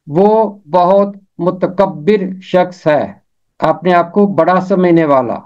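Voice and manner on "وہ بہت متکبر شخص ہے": بہت متکبر شخص ہے said slowly and deliberately; slow example sentence. Shown as a demonstration. Teacher slowly models متکبر in a sentence. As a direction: draw the example out for the class. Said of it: "اس کا تلفظ ہے متکبر".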